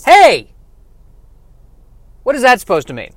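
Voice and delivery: high, loud indignant outburst; offended. Acted. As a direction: protest an insult as an affronted playing card. Two of Hearts takes offence.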